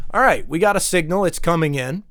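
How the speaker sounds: ordinary delivery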